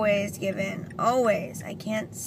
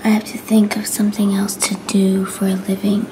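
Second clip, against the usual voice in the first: whispering; 7 dB louder than usual. Real, not acted. Whispers to camera throughout.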